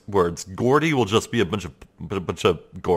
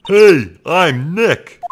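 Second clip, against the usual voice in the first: loud, higher call-out; louder; 7 semitones higher, 9 dB louder, much slower than usual. Acted. Testing a sound-triggered camera with a greeting, louder. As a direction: say it again at full volume. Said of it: "not saying it loud enough".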